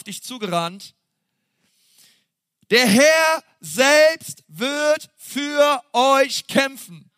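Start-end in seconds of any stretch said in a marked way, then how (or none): 2.7-7.1 high, very slow, ringing proclamation; proclaiming preacher. Acted. Preacher proclaiming God's promise to the congregation, slow and ringing. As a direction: declare it over the church, word by word.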